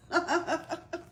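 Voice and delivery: high cheerful laugh; a big jolly chuckle; buffalo laugh. Acted. The buffalo laughing at the elephant's question, merry laugh.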